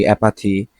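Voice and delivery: usual speaking voice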